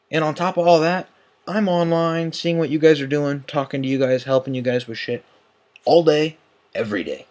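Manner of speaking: 1 heated listing. Real, not acted. Listing everything he does.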